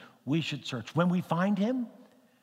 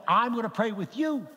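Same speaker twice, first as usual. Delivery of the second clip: raised, emphatic comic insistence; retold insistence; 7 semitones higher, 3 dB louder than usual. Preacher retelling his reply to reluctant Kathy, insistent. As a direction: insist playfully, pitched higher. Insists, topping her refusal.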